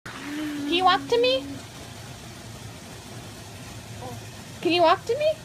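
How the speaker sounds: high, soft sing-song coaxing. Real, not acted. Mother coaxing her baby to walk, high and soft.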